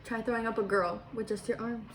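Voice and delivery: ordinary delivery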